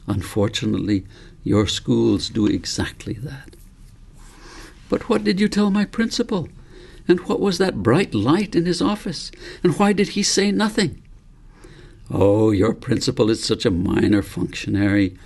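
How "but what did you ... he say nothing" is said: put-on a lightly raised, eager boy's voice; a hint of a puzzled youngster firing questions; young David voice. A narrator's reading. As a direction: let the curious boy pour out questions, pitched up. David questions grandfather again.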